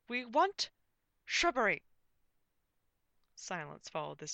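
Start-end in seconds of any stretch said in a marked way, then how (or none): 0.1-1.8 loud, high declaration; grand comic knight voice; pompous knight. A narrator's reading. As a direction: proclaim the demand with a dramatic pause. Knight finishes grand demand.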